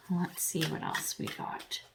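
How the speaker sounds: whispered aside to herself. Real, not acted. Reader checking the next cards, whispered. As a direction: breathe it under your breath while checking cards.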